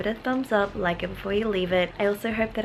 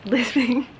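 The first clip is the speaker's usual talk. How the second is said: laughing lisp through a retainer; wearing a retainer; 5 semitones higher, 6 dB louder than usual. Real, not acted. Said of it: "I'm lisping right now".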